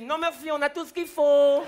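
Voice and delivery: dad cheerfully declining the cop; polite drunk dad. Retold or imitated. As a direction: answer sweetly as if offered a drink. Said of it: "Mon père, il a dit".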